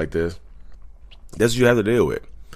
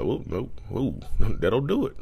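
high, quiet, fast mock reassurance; playfully reassures viewers in a high, quiet voice; 6 semitones higher, 4 dB quieter than usual.

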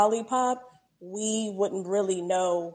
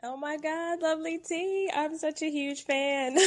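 excited fan; 8 semitones higher, 4 dB quieter than usual. Real, not acted. Caller greets her favourite host.

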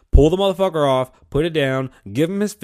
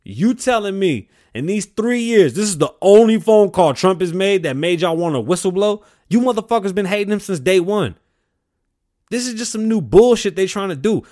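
angry rant; 5 semitones higher, 6 dB louder than usual. Real, not acted. Comedian ranting to the crowd about whistleblowers, loud and angry. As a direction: let the outrage build and boom it out.

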